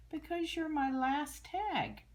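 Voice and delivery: high, loud, slow teacher voice; drawn out like a beaming teacher; cheerful teacher. Acted. Teacher telling Amelia about her name tag, high and slow.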